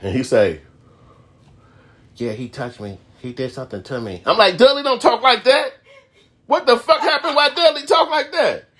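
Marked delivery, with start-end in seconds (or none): 2.2-4.2 soft, weird confession voice; hushed, as a troubled child confessing; imitating Dudley. 4.6-8.7 high-pitched, bewildered outcry; shocked disbelief. Retold or imitated. Acting out his shocked viewer reaction.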